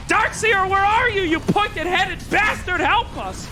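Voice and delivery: high-pitched comic rant at a player; exasperated caster outburst. Pretend. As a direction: call out in mock outrage. Caster scolding a player mid-fight.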